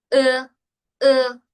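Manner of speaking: "e" stretched and loud, vowel glides; slow-motion Chinese e. Shown as a demonstration. Teacher showing the slow version of the vowel. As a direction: drag the vowel out slowly. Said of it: "Si yo lo digo en cámara lenta".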